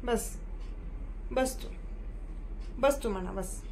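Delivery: softening, fading commands to sit; from soft to barely audible; soothing hush. Real, not acted. Settling the pet down.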